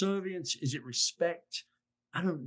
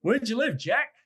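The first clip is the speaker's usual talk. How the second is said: higher, a bit louder, plain friendly ask; direct and friendly; 7 semitones higher, 5 dB louder than usual. Shown as a demonstration. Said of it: "just use direct language and just ask".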